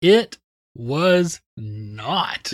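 very slow, word by word; emphatic denial. Real, not acted. Co-host answering the youth group question, slow emphatic denial. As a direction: draw out each word with a pause between.

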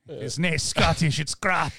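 put-on slow, rough stab at a Scottish accent; badly faked Scots, drawn out; Scottish imitation. Host apologizing to a Scottish listener, in a bad Scottish accent. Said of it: "I won't ever do another Scottish imitation".

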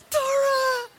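high, awestruck squeal, imitating a child; mesmerized child. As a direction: cry it out like a starstruck toddler. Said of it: "so mesmerized by this person".

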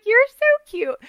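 very high, doting squeal; gushing coo. Retold or imitated. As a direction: coo it sky-high like an adoring mom. Gushes at her son in retelling.

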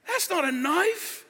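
high, drawn-out Australian bushman imitation; Nick Dundee. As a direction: stretch the catchphrase slowly upward. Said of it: "All of a sudden he goes".

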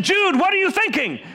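sharp, high-pitched yell at his son; angry dad voice. Retold or imitated. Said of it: "I was using my angry dad voice".

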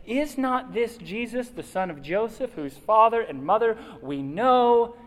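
mock the claim, doubtful; skeptical grumbling. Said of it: "They said".

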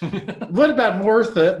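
slow, chuckling through the words; laughing. Real, not acted. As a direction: laugh while saying it. Laughing at own idea.

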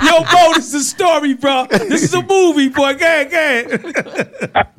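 high, excited words through laughter; laughing. Real, not acted. Host urging the storyteller on, laughing hard. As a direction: exclaim while cracking up.

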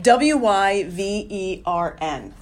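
slow letter-by-letter W Y V E R N; spelling it out. Shown as a demonstration. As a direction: spell out W Y V E R N one letter at a time. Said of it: "Spell it for me".

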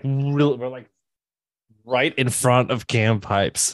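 ordinary talk, nothing marked

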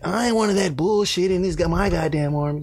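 a hint of a high drill sergeant; lightly voiced tough sergeant; Boyka drill sergeant. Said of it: "he was like".

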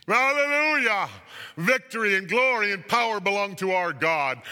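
triumphant full-voiced cry; jubilant shouting. A narrator's reading. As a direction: shout praise like a vast throng. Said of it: "and they were shouting".

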